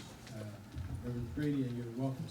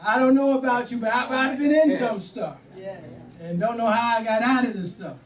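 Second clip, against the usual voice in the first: much higher, much louder preaching; preaching at full force; 8 semitones higher, 9 dB louder than usual. Acted. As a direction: whoop it up to the church.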